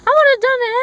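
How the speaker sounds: loud, high, insistent; emphatic shout. Real, not acted. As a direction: belt it out to end the point. Repeats line, shouting it.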